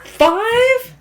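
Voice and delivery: very high, loud playful shock; mock surprised. Real, not acted. Child says turning five.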